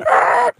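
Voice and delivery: very high mouthed brake squeal; imitated tire screech. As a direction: screech like braking tires.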